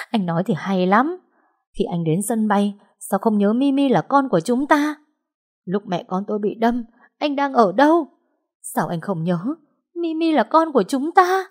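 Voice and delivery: anguished, high reproachful questions; bitter accusation. Acted. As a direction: accuse him, voice climbing.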